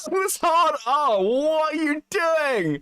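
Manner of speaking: high, slow, thin imitated whine; mosquito whine. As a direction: mimic the voice changer's whine. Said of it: "the voice changer makes it worse".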